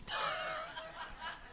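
breathless high laugh; high squealing laugh. Real, not acted. Preacher cracking up at his own story, squealing laugh. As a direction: let a squeaky laugh burst out.

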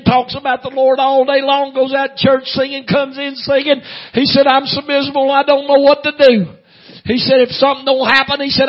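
loud, high sustained intoned cry; strained, chanting revival delivery of a miserable man; high-pitched preaching. Retold or imitated. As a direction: wail the butcher's story on one high note. Preacher voices the weeping butcher.

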